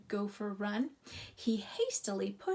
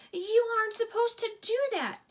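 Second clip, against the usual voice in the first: scolding dog; 12 semitones higher, 7 dB louder than usual. A narrator's reading.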